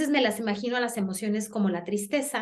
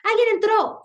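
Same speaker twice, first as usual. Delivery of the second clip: very high, loud alarm shout; policeman's shout; 10 semitones higher, 6 dB louder than usual. Acted. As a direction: yell like a guard who caught an intruder. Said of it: "el policía va a gritar". (grito alarmado de policía)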